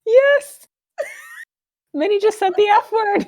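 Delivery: very high, laughing squeal; scandalized glee. Acted. Confirms Minnie cursed, high scandalized glee. Delighted scandal at child cursing.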